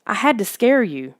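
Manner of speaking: usual speaking voice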